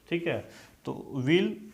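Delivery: normal talking voice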